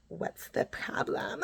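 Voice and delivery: slow, hushed mock challenge; imitated confrontational mom. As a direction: mimic her coming at you, drawn out under the breath. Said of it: "the way that she came at me".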